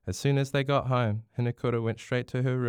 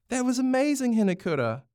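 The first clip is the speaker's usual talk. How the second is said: high, thrilled cheer; excited scream; 13 semitones higher, 4 dB louder than usual. A narrator's reading. Louis praises Hinakura's powerful goal, screaming excitedly. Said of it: "Louis screamed".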